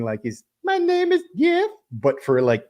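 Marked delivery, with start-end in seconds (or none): 0.6-1.7 goofy put-on voice, pitched way up and loud; loud, very high comic character voice; Channing Tatum impression. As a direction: imitate a silly high-voiced movie catchphrase. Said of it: "Channing Tatum from Twenty-two Jump Street doing".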